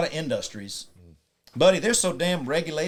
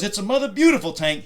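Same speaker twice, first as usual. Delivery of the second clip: loud, raised-pitch retold comeback; emphatic retort; 8 semitones higher, 7 dB louder than usual. Oddball defending his new tank, emphatic. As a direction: argue back with loud enthusiasm.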